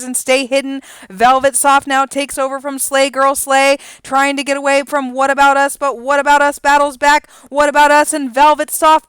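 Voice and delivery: fast, loud rising race call. Acted. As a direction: call the stretch run with rising excitement. Calls a horse race.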